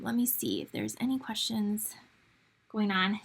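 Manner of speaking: whispered to herself while scanning the comments; whispered aside. Real, not acted. Host checking live chat questions, whispering to herself. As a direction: drop to a breathy whisper, just for yourself.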